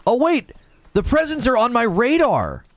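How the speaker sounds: high-pitched burst of discovery; excited realization. Real, not acted. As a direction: suddenly realize it, voice leaping high. Suddenly spots presents on radar.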